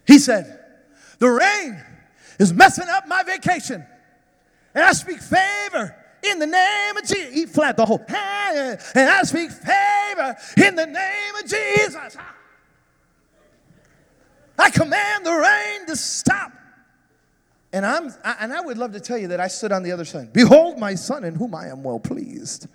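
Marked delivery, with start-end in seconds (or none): 1.2-7.3 high and louder, rising in faith; loud child's prayer. Retold or imitated. Son praying against rain. 8.1-16.5 high, loud, slow decree; boy commanding the rain to stop; commanding shout. Retold or imitated. Son commanding rain, full voice. 20.3-22.7 mock voice of God. Mock divine pronouncement.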